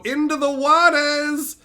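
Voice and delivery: high, loud, drawn-out farewell call; hammed-up sign-off. Streamer signing off to the viewers, hammed-up high call. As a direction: call out the catchphrase with stretched endings.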